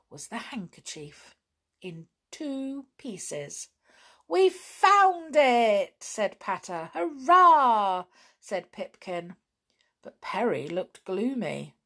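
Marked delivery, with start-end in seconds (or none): 4.3-6.0 loud, high, drawn-out cry of discovery; triumphant shout at the find. A narrator's reading. Pixies find the lost handkerchief. 6.9-8.0 loud, high, jubilant cheer. A narrator's reading. Pixie cheers the find.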